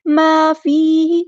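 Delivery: slow, drawn-out recitation; slow Quranic recitation. Shown as a demonstration. Teacher reciting verse words slowly for students. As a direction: stretch every vowel as in tajweed.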